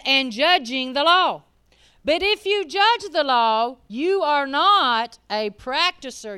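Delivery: loud, slow, deliberate; hammering the verse home slowly; slow preached scripture. Acted. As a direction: lean on every word.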